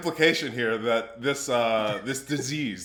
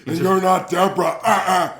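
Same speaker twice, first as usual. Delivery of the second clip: sassy retort; 4 semitones higher, 5 dB louder than usual. Acted. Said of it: "And Mansoor says".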